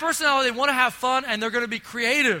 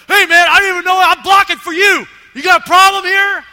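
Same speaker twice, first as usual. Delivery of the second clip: loud, high and combative; angry comeback; 7 semitones higher, 13 dB louder than usual. Retold or imitated. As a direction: snap back at him, spoiling for a fight. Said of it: "he turns around and is like".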